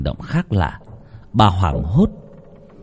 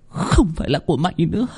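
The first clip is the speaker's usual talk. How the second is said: higher, quick eerie taunt with a rising end; possessed taunt; 8 semitones higher, faster than usual. A narrator's reading. Possessed girl taunts her reflection, high and fast. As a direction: sneer it fast in an unearthly voice. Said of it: "nhìn mình trong tấm gương".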